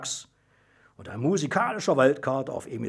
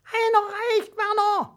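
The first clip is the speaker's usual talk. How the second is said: loud, very high squeaky referee; bossy little mouse; 6 dB louder, slower than usual. A narrator's reading. Little mouse referee stops contestant Werner, bossy squeak. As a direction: squeak out a firm ruling. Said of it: "bestimmte das Mausel als oberster Schiedsrichter".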